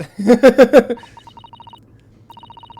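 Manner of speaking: real, loud burst of laughter. Real, not acted. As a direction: crack up at the twist. Player laughs loudly.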